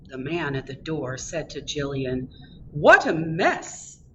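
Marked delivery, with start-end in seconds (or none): 2.7-4.0 slow, loud, drawn-out dismay; disapproving. A narrator's reading. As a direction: stretch out each word in disapproval. Doorman disapproves of dirty girl.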